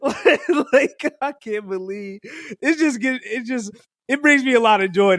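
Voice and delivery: teasing, creased up with laughter; breathless giggling joy; laughing. Real, not acted. Laughing about weave and bundles.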